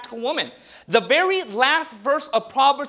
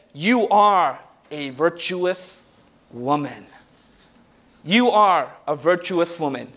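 Boaz speaking; 6 semitones lower, slower than usual. Acted. Boaz praising Ruth.

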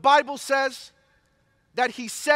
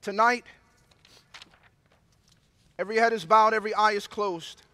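prayer voice; 2 semitones lower than usual. Acted. Leads closing prayer.

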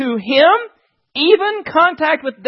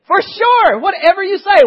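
eager agreement; 8 semitones higher, 4 dB louder, slower than usual. Acted. Grateful crowd agreeing eagerly.

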